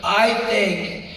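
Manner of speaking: hammed-up speechmaker with erratic volume; Donald Trump. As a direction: drag out the words like a mock speech-giver. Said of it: "he'd just be giving a speech".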